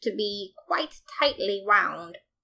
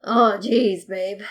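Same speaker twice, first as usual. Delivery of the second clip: grumbled; 6 dB louder, much slower than usual. A narrator's reading. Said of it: "she grumbled".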